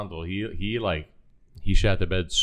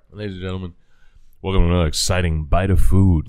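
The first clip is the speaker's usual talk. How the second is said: mock announcer; 2 semitones lower, 8 dB louder than usual. Announcing another bite on mic.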